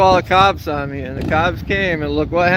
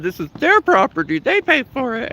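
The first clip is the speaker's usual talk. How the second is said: high, sing-song mock of her voice; parroting the woman's claim in a prissy high voice; mocking quote; 11 semitones higher than usual. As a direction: mimic her smug certainty. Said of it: "That was the one who said".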